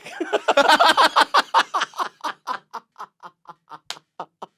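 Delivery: high, loud burst of laughter. Real, not acted. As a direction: laugh hard at the memory. Laughs at his own story.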